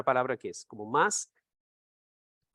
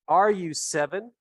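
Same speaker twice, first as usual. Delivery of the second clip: "Are you seven?" slow, higher and louder; slow, raised teacher model of "Are you seven?"; slow model question; 4 semitones higher, 5 dB louder, slower than usual. Shown as a demonstration. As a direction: lay out "Are you seven?" slowly for learners.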